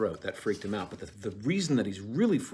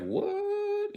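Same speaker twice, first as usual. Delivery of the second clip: casual surprise. Retold or imitated. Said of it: "He's, he's just like".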